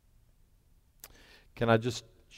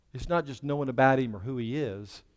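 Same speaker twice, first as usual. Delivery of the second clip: ordinary delivery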